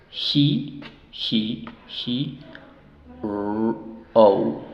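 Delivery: "He" as "hee", then "r-", then "o" as "ou"; sound by sound. Shown as a demonstration. Teacher sounding out open syllables, hee, r, ou separately. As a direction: break hero into isolated sounds with pauses. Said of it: "Sílabas abiertas las dos".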